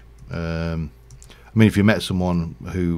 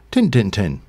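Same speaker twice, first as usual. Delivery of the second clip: Yorkshire "tin, tin, tin", higher and louder; thick Yorkshire accent; 3 semitones higher, 6 dB louder than usual. Retold or imitated. Grandson's reply after looking in the tin, thick Yorkshire accent. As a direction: fire back three near-identical syllables in Yorkshire dialect. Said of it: "that's probably about as thick as my, my level of Yorkshire accent got".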